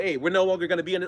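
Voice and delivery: ordinary talk, nothing marked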